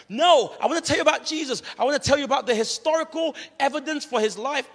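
loud, high, relentless preaching; hammed-up zealous street preacher; exuberant evangelist. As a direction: barrel over their refusal at full volume. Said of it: "he is very exuberant, very sort of out there".

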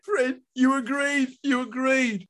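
high-pitched, drawn-out reproach; distressed plea. A narrator's reading. Wife reminding husband that he agreed, distressed. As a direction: wail it imploringly.

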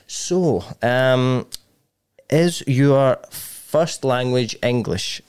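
slow, halting interviewer question; imitating hesitant interviewer. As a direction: pick out each word slowly, as a careful interviewer. Acts out hesitant Vietnamese interviewer.

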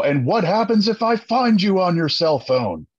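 mock drill instructor grilling recruits; R. Lee Ermey. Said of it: "I go full R. Lee Ermey".

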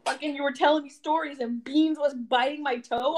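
ordinary talk

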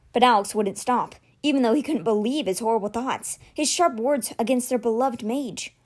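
ordinary delivery, nothing marked

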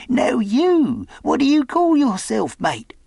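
high, loud character voice; curious castaway. Acted. Castaway asks his new mate's name, eager and high. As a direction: pipe up as a marooned sailor.

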